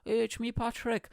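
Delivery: lightly put-on Irish voice; Irish accent. Podcaster recalling his Patrick bit, Irish accent. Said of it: "I made Irish accent on this podcast".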